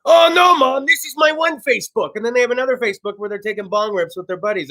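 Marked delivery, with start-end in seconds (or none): 0.0-2.1 high, loud mock teenager protest; mock teen. A teen fooling his mom, high mock innocence. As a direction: play the innocent kid lying to mom. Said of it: "what kids do to throw their parents off".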